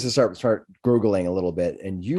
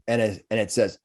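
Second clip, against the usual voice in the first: normal talking voice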